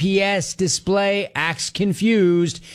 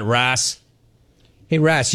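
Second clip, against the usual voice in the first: usual speaking voice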